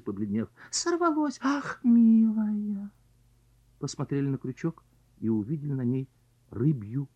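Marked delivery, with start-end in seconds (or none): 0.7-2.9 high cry, then sighed dismay. Acted. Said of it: "вскрикнули".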